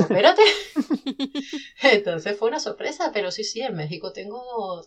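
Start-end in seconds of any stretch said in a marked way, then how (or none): 0.0-0.4 loud startled wait-what with a laugh; surprised. Retold or imitated. Author spotting her novel on the list, loud laughing surprise. 0.5-1.8 bright burst of laughter; real laugh. Real, not acted. Laughs at the surprise.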